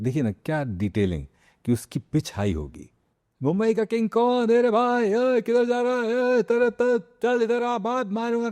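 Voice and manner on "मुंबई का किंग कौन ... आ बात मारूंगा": much higher, street tough from the Mumbai slums; Bhikhu Mhatre high pitch. Retold or imitated. Actor showing how Bhikhu Mhatre talks, high-pitched tough. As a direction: pitch it way up like a swaggering slum gangster. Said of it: "pitch ऊपर हो गई".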